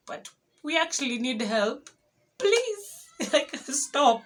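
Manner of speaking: desperate high-pitched plea to stop; rising desperate plea. Retold or imitated. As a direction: voice shoots up high and loud as the plea gets desperate. Begging passing car to stop.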